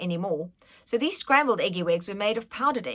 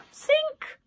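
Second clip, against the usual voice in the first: high nanny offer. Acted.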